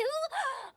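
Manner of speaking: high, sharp gasp of surprise; surprised gasp. Acted. Registrar hearing of a second prize, gasps high. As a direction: gasp as news catches you off guard.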